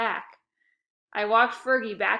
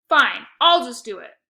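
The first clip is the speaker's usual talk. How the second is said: loud, fed-up huff; exasperated grunt; 3 semitones higher, 13 dB louder than usual. A narrator's reading. Lexi gives up on Shelby with a loud exasperated grunt. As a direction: give in with a grunt. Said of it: "with a big grunt".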